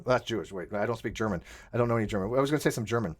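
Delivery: ordinary talk, nothing marked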